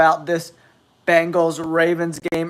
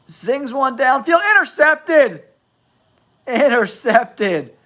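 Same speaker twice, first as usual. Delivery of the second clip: high, rising shout on the big play; thrilled sportscaster's cry; excited play-by-play; 8 semitones higher than usual. Real, not acted. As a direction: call the interception live, pitch leaping up. Live call of an interception.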